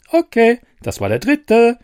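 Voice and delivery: a tiny fairy with the last word; smug fairy. Acted. Fairy grants last wish.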